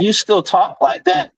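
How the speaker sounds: high, incredulous question. Retold or imitated. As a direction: act stunned he still talks like that. Incredulous retort to customer.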